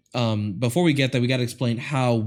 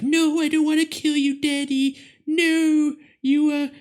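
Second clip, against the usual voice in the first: put-on very high, pleading girlish voice; daughter's voice. Daughter begging her bitten father, very high mock plea. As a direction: squeak like a teary little daughter. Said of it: "she's like".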